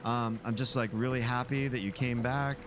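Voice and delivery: mock parasocial streamer greeting; overfriendly Twitch streamer. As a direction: gush over the viewer like a streamer hungry for friends. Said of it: "and they talk like this".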